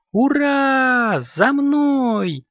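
very high, drawn-out kitten cheer; joyful cheer. A narrator's reading. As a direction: cheer high and rally the others. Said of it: "обрадовался Коржик".

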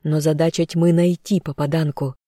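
ordinary delivery

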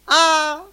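high, loud gasping cry before fainting; startled old lady's shriek; shocked cry. Acted. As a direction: cry out and collapse. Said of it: "воскликнула генеральша".

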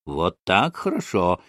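ordinary delivery, nothing marked

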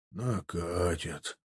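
slow, low and quiet; deep bass. A narrator's reading. Veteran soldier predicting the attack, low bass. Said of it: "пробасил Данилов".

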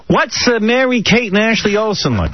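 high-pitched imitation of curious kids; eager kids. Kids quizzing a TV star at dinner, eager high voices. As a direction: pipe it up like an excited child. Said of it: "and the kids started".

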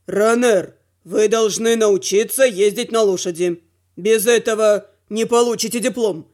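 low male instructor voice; stern teacher. A narrator's reading. Teacher lays down the rule.

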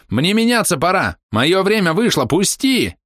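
high, loud shriek. A narrator's reading. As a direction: shriek to be let go. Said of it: "верещал Жан Лентяй".

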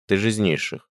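ordinary delivery, nothing marked

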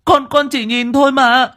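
put-on a scared child begging his mother; frightened boy pleading with his mother, terrified. A narrator's reading. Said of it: "Thằng bé kinh hãi".

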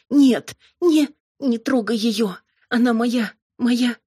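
high, loud, broken cries; panicked plea. A narrator's reading. Robin stopping the demon harming Amalia, panicked high plea. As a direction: cry out to protect her, desperately.

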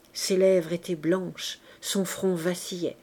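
ordinary delivery

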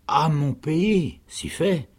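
slow, high, drawn-out realisation; dawning understanding. A narrator's reading. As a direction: let it dawn on you slowly. Woman suddenly understands the word country. (lente prise de conscience, voix aiguë)

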